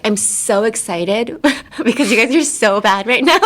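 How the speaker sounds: mock thrilled coach, breaking into laughter; over-enthusiastic coach. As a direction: gush at a losing team, tongue in cheek. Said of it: "your coach isn't gonna be like".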